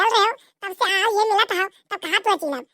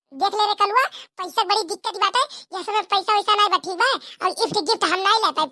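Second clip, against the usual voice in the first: ordinary delivery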